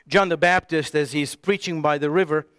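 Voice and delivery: ordinary talk, nothing marked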